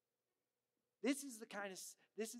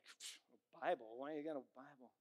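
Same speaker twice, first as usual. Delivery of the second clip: rude scoffer; 4 semitones lower, 4 dB quieter than usual. Retold or imitated. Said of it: "somebody says something rude to them".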